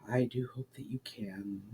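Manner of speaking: soft unvoiced whisper, fading hope. A narrator's reading. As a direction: breathe it out almost in a whisper. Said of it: "almost in a whisper".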